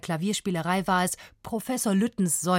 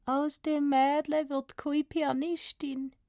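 put-on high, slow, dreamy Swabian girl; Swabian refrain; 8 semitones higher, slower than usual. A narrator's reading. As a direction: echo it slowly in a girl's Swabian. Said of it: "seine Tochter schwäbeln". (verträumt nachhallendes Schwäbisch)

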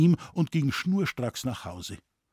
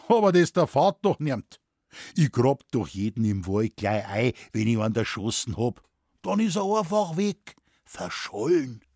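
Moosbichler. Acted. Forester reassures scholar nobody finds the bodies, blithe Bavarian. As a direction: explain it blithely in dialect. Forester explains burying victims.

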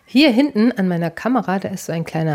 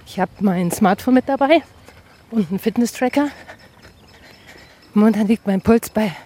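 talking while jogging; while running; 3 semitones higher than usual. Real, not acted. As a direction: speak mid-stride, jogging. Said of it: "und ich jogge gerade".

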